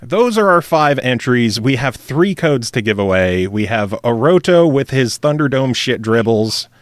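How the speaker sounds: ordinary delivery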